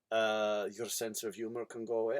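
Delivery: ordinary delivery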